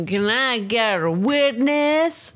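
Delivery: put-on slow drunken call to the crowd. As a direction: call out like a tipsy preacher. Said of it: "In my drunk voice".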